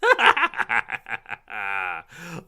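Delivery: loud cackling witch laugh; evil witch laughter; witch's cackle. Acted. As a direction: cackle long and wickedly like a witch. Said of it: "like a witch's laugh".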